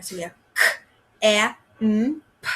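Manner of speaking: camp split into k, a, m, p; teacher segmenting camp for the class; sounds segmented. Shown as a demonstration. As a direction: sound out camp one phoneme at a time. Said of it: "That time it had four sounds".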